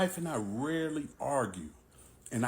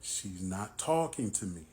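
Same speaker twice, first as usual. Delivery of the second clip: plain reading voice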